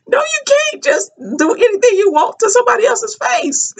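high, strained pleading protest; a hammed-up high plea; high mock pleading. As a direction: plead it high and half-laughing. Retelling the confrontation, laughing.

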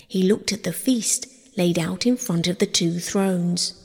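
usual speaking voice

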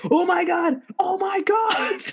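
high panicked cries of oh my God; screaming on the drop, half laughing; reenacted ride panic. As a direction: relive the terror, voice cracking high. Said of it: "I'm just completely just yelling and screaming".

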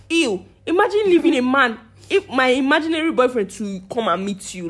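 ordinary talk, nothing marked

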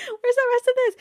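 high disbelieving yell. Real, not acted. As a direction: shout it in mock outrage. Reacting to missing prophecy.

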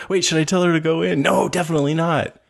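high, fast mock panic. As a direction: act out a frantic mother. Mock panicked film dialogue.

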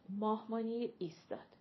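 plain reading voice

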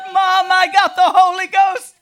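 loud, high, overjoyed call; excited shout. Retold or imitated. Calling her mother from the campground, shouting with joy. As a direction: shout the news to mom. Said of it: "she was shouting and I was crying".

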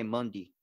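"Monday" said as "Mun-dee"; local pronunciation. Shown as a demonstration. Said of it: "sometimes I'll say Monday".

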